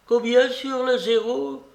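high-pitched, demanding question; old lady calling out. A narrator's reading. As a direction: pipe up insistently to get his attention. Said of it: "cria-t-elle en poussant le coude du croupier".

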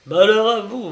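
loud, high declaimed threat; dire warning. A narrator's reading. Admiral warns princess of vengeance.

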